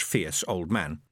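ordinary delivery

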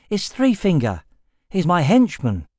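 high, bright eager youth voice; excited young man. A narrator's reading. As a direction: bubble over with boyish excitement. Boy introduces companion.

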